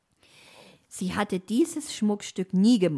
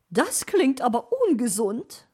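worried magpie; 5 semitones higher, 4 dB louder than usual. A narrator's reading. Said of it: "war Frau Elster besorgt".

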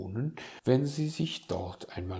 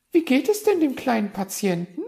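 high, loud, quick question; eager magpie inquiry; 10 dB louder, faster than usual. Acted. Magpie dodging blame by asking after patient, quick. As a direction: switch topic fast in a high bird voice.

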